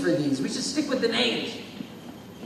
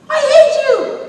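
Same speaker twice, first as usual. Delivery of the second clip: much higher, loud whiny cry; shrill victim voice; 13 dB louder, slower than usual. Acted. Victim yelps back at the bully, high and loud. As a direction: squeal it like a hurt kid.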